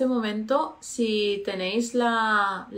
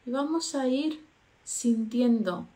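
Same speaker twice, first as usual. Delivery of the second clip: guided meditation; 4 dB quieter than usual. Acted. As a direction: lead them gently into calm.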